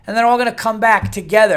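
usual speaking voice

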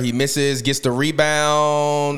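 casual commentary calling the game; play-by-play. Acted. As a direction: call it like a commentator. Live play-by-play.

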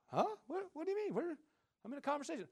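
high, puzzled reply; lightly voiced bewildered wife; wife interrupted mid-chat, baffled and high; confused wife.

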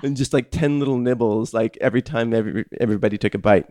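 ordinary delivery

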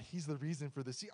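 softly imitated solemn profundity; mock profound. Preacher mimics believers reciting a slogan, mock profound. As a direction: say it as if deeply profound, mocking. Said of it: "you're like, so profound".

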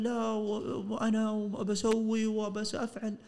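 höher und langsam, großspurig gestottert; nachgeäffter Kunde. Retold or imitated. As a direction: den Angeber hoch und gedehnt nachmachen. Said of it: "قال".

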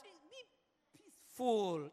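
very slow Be peaceful; slow calm. Shown as a demonstration. Pastor showing peaceful speech, slow. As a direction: draw it out calmly. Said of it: "let's stop that".

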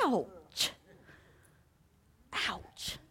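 soft, fading ouch, last one breathed; hushed hurt ouch; pained ouch. Retold or imitated. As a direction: wince quietly at the sting. Stung by God's words.

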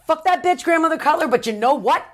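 psyching herself up, voice climbing high; fired-up rant. Retold or imitated.

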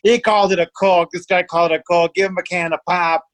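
imitating laughing Minnesotans with their accent. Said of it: "they're like".

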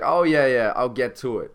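loud, breezy noncommittal agreement; casual brush-off. Acted. Imagined person putting off a Lamborghini offer, casual brush-off.

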